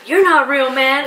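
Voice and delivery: mock stoner dismissal; Creed impression. Wife quoting Creed brushing Jim off. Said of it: "And Creed says".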